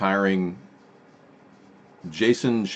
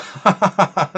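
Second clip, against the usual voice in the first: very high cartoon cackle, louder; high goofy laugh; 11 semitones higher, 7 dB louder than usual. Awesome Carl laughs at the poll, high cartoon cackle. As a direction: let out a silly puppet laugh.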